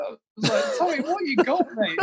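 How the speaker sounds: very high, giggling through the words; laughing while talking. Real, not acted. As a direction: crack up while quoting yourself. Laughs while retelling his reply.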